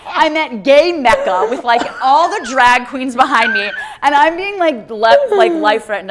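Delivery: high, loud, slow laughing; laughing disbelief. Real, not acted. Telling his friend about the threat, laughing loudly.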